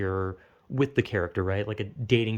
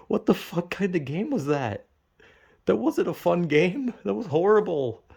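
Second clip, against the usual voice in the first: higher-pitched, indignant protest; appalled disbelief; 7 semitones higher than usual. Retold or imitated. Dreamer protests about a horrible game, indignant disbelief. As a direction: push it up high, thoroughly appalled.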